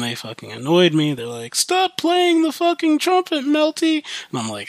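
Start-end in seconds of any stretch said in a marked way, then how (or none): 1.5-4.0 high-pitched mock scolding; shrill-pitched nagging imitation; retold scolding. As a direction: imitate an exasperated parent's complaint, pitched way up.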